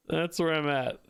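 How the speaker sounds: normal talking voice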